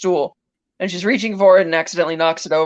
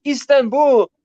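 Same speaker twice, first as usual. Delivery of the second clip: higher-pitched mock confession; blurted confession; 7 semitones higher, slower than usual. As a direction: blurt it out as if finally cracking. Character finally fesses up.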